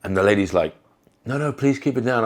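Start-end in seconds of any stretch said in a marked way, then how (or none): 1.2-2.2 higher-pitched imitation of an anxious plea; imitated pleading. Shop lady pleads with angry customer.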